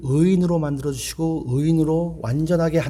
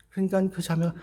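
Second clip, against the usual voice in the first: ordinary talk, nothing marked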